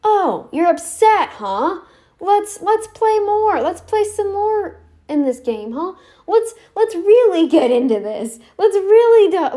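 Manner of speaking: loud, high-pitched provocation; goading someone upset. Acted. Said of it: "my go-to is to be like".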